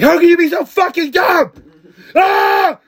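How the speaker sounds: high, loud mock fury; mock Gordon rage. Gordon yelling at a cocaine maker, mock furious. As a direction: rant like an outraged chef. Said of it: "He's like".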